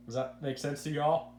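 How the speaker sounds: ordinary delivery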